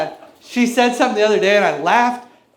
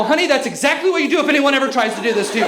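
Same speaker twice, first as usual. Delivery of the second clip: rushed fatherly praise; 2 semitones higher, much faster than usual. Retold or imitated. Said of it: "and I go".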